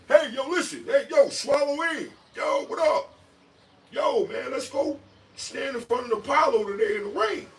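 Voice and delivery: high, loud hollering mimicry; calling a friend. Man calling his friend up, mock hollering. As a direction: yell it like summoning a buddy. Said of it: "that's gonna call their friend".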